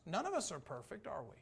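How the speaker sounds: ordinary talk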